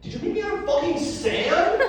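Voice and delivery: hammed-up outrage at being made of sand; incredulous outburst. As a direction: act out waking up baffled and indignant. Adam confronts God, bewildered.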